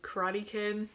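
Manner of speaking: ordinary talk, nothing marked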